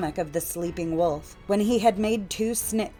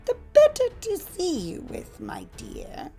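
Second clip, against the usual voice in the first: wolf posing as grandmother; 13 semitones higher than usual. A narrator's reading. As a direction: coo it as the disguised wolf, then hush. Disguised wolf replies.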